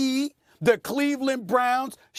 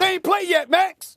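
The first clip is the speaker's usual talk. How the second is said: raised, insistent protest; heated protest; 6 semitones higher than usual. Real, not acted. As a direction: push back hard on Max.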